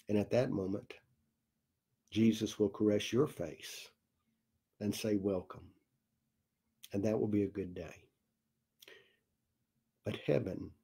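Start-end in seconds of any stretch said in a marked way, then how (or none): 5.2-5.7 tender welcome. Acted. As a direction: welcome them home gently, softer. Jesus welcoming a soul to heaven.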